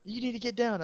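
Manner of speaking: mock stern teacher. Student imitating staff telling him to get off table, high nagging. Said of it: "they're like".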